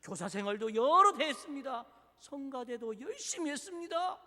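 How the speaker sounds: acted, insistent protest to God; resentful complaint. Church elder asking God why he suffers, resentful complaint. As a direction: plead your record with mounting grievance. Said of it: "하나님께 원망합니다".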